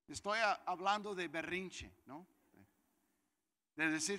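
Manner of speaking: usual speaking voice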